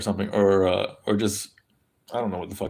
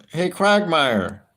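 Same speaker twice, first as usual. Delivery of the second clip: high, slow cartoon dad voice; Peter Griffin impression; 6 semitones higher, much slower than usual. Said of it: "that's my Peter Griffin impression".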